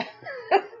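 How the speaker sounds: high burst of laughter. Real, not acted. Laughing at herself.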